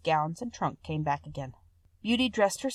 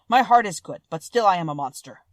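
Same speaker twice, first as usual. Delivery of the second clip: louder, heavier monster voice; a gentle monster, loud and earnest; Beast voice; 9 dB louder than usual. A narrator's reading. Beast admits he is a monster, louder.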